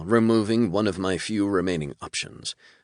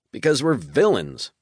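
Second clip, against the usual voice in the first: plainly; 8 semitones higher, 4 dB louder than usual. A narrator's reading. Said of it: "Yali said plainly".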